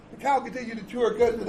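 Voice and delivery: normal talking voice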